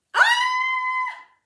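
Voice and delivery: very high, loud scared scream; shrill scream. Acted. Verča seeing the piglet land on her, shrieking. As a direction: scream in fright. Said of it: "vykřikla Verča".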